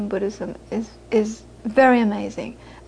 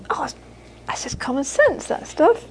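lightly voiced dismissive mother; imitated mother; 4 semitones higher, 4 dB quieter than usual. Mother answers what she thinks of Buddhism, lightly imitated dismissal. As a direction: shrug it off as the mother would. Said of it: "And she'd say".

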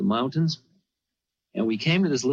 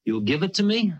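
imitating the guru; 10 semitones higher than usual. Guru teasing the visiting seeker.

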